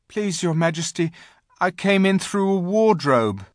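put-on high-pitched pleading boy's voice; anxious boy. A narrator's reading. Edmund explains himself to the Queen, anxious. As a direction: explain meekly in a high young voice.

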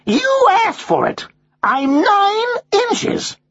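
high, slow, emphatic parrot voice; nettled. A narrator's reading. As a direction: spell it out as an irritated American bird. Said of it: "nettled by the policeman's tone".

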